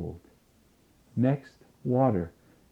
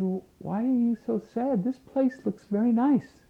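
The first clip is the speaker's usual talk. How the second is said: higher, curious question to a sad man; puzzled general; 12 semitones higher than usual. Acted. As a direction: ask with mild surprise. General asks Hitler why sad.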